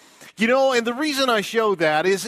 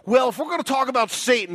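ordinary delivery

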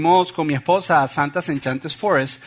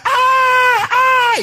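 high, loud shriek of fright; shrill screams imitating a startled wife; wife's scream; 7 dB louder than usual. Husband imitates his wife upstairs on seeing something, screaming. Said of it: "mi esposa grita".

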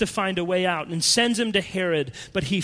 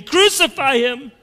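crowd cry; 6 semitones higher, 6 dB louder than usual. Retold or imitated. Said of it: "we're there with them saying".

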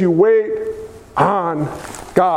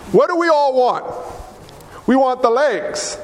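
ordinary talk

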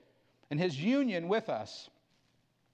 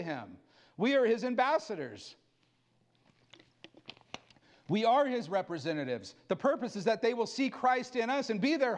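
fervent pulpit preaching to the church; 5 semitones higher than usual. Acted. Pastor preaching to his church about Christ's power, fervent. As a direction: preach it with conviction, building.

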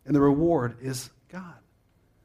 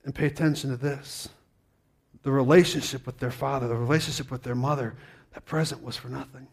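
ordinary talk

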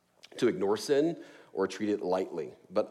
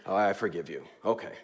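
grudging forgiveness; 5 semitones lower, 3 dB quieter than usual. Retold or imitated. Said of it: "maybe not genuine".

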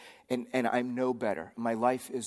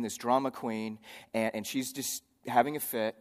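ordinary delivery

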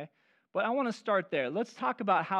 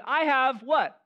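1 mock disbelief; 6 semitones higher, 6 dB louder, slower than usual. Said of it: "you opened the closet and you said".

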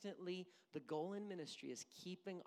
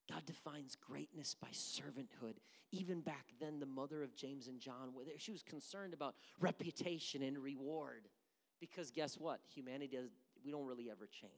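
ordinary talk, nothing marked

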